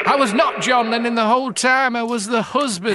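very high, loud character voice; high put-on voice. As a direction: squeal it in a silly high voice.

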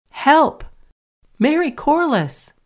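high frightened call for help. A narrator's reading. Katie calls up to Mary from the hall, high frightened cry for help. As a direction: shout up the stairs in alarm. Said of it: "It was Katie's voice that called".

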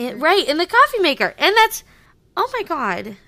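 high, loud, fast excitement; excited. Real, not acted. Excited fan recalling the coffee maker. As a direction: burst out with it.

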